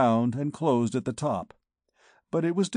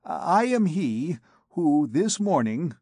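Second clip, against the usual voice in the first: stuttering; 4 semitones higher, slower than usual. A narrator's reading.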